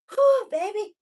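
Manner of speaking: strained, high, loud and breathless; straining under effort. Real, not acted. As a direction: push through the burn, half laughing. Straining through last seconds of plank.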